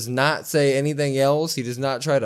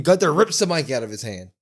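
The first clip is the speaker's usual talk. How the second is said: ordinary delivery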